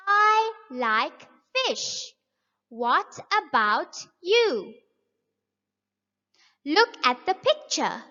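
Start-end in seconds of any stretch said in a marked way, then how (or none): 0.1-4.7 slow, clear, every word spelled out; slow model reading. Shown as a demonstration. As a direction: read it slowly for young learners. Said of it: "Let's read this next example together".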